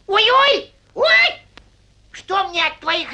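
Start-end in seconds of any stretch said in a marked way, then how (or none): 0.1-1.4 loud high ouch cries; high pained yelp. Acted. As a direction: yelp at the sore leg. Hare cries out in pain.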